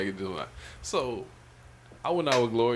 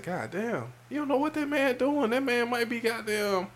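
high-pitched, incredulous retelling; squeaky-high mock exasperation; retold protest; 11 semitones higher than usual. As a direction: plead his case with the voice way up. Retold protest defending the absent man.

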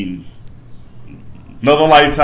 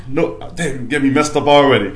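usual speaking voice

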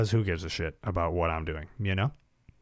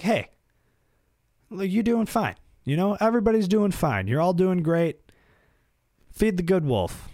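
cheerleading pep talk; 10 semitones higher, 3 dB louder than usual. Acted. Encouraging everybody, pep talk.

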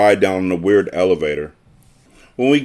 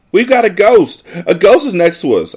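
much higher, louder frightened cry; panicked shriek; 10 semitones higher, 5 dB louder than usual. Acted. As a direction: shriek it in terror at the ghost. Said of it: "I shrieked".

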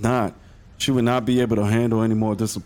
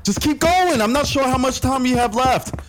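high, loud, rushed calls; urgent shouts on the run; shouting while running; 13 semitones higher, 3 dB louder than usual. Acted. Running to catch the flight.

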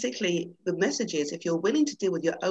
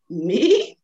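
whimpered; 4 semitones higher, 10 dB louder than usual. Acted. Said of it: "whimpered Leo".

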